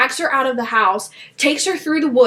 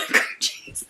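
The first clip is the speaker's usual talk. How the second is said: very high squeaky laugh-through; laughing cream cheese; 8 dB quieter than usual. Real, not acted. Mocking the silly book line, a squeaky laughing reading. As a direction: giggle it out.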